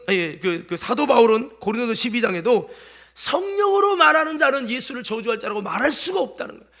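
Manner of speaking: usual speaking voice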